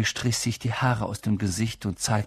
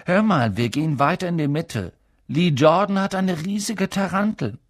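excited twin; 8 semitones higher, 3 dB louder than usual. Acted. Twin excited about a tarantula.

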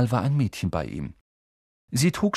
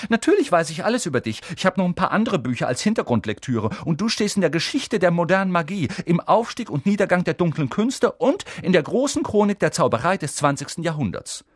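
put-on high, brisk know-it-all girl; Hermine, showing off; 8 semitones higher, 5 dB louder than usual. A narrator's reading. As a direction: reel off your reading with importance.

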